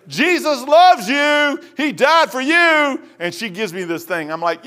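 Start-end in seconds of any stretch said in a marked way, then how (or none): 0.1-3.0 loud, high, drawn-out proclamation; street-preacher call. Retold or imitated. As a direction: proclaim it slowly to someone who won't listen.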